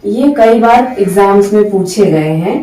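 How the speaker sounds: normal talking voice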